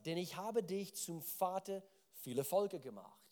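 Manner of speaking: scripture reading. Acted. Reads God's renaming of Abraham.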